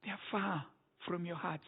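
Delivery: hushed echo of the charge; hushed repeat. Acted. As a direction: let it sink in quietly. Repeats the line, dropping way down.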